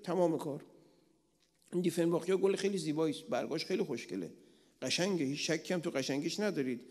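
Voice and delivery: usual speaking voice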